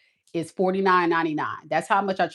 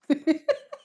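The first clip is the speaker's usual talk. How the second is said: a high, teary laugh; 9 semitones higher than usual. Real, not acted. She laughs off her own tears, a high teary laugh.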